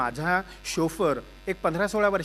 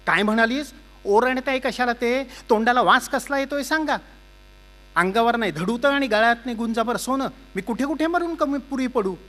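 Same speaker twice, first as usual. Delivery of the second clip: loud, higher, bitter shouting back; heated retort; 5 semitones higher, 5 dB louder than usual. Acted. Husband and wife quarrelling behind the door, loud bitter shouting. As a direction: hurl the complaint back.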